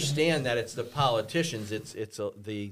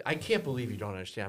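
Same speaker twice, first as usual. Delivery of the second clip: normal talking voice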